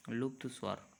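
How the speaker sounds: usual speaking voice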